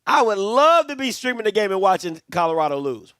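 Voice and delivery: ordinary talk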